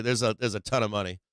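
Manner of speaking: ordinary talk, nothing marked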